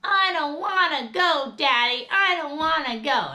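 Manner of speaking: imitating a whining kid; whiny child. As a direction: beg like a toddler refusing to leave. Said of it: "his son goes".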